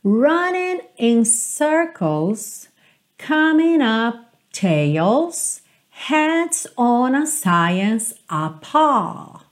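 very slow, each word laid out; slow lyric reading. Shown as a demonstration. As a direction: read the lyrics at half speed. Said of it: "reading lyrics slowly".

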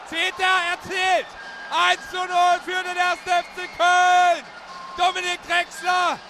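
yelling the goal call; roaring Tor over the crowd; screaming goal commentary. Real, not acted. Radio goal call, first goal.

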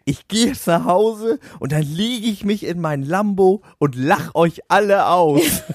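high, slow boastful voice ending in a laugh; mock bragging drunk. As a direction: sneer like a show-off millionaire. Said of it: "hat dann noch gesagt".

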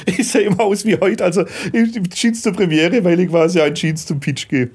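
amused, talking through laughter; grinning and laughing out the answer; a laugh carried into the words. Real, not acted. Laughing at the question.

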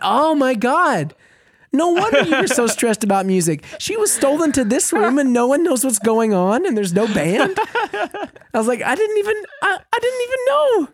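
building to an incredulous high-pitched squeak; stunned realization. Retold or imitated. Retelling finding out the bride was stolen, building disbelief.

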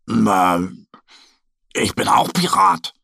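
casual bear reply. Acted. Mo answers Annie, light bear voice. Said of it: "sagt Mo".

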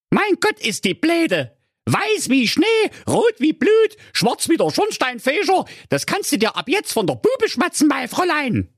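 schrill triumphierende Hexe; höhnisch und laut kreischen; böse Direktorin. A narrator's reading. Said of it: "lachte grausig". (kreischende Hexenstimme)

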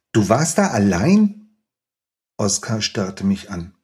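measured, expressive reading aloud of a scene; story reading. Acted. Author reading his novel to the interviewer, expressive storytelling. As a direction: read the chapter to the audience with feeling.

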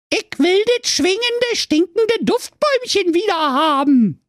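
very high, demanding princess voice; a spoiled high demand; whiny princess. Acted. The princess demands the tree back, very high and whiny.

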